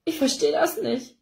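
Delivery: a touch of tearful mother; sobbing. A narrator's reading. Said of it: "schluchzt Ella neben mir".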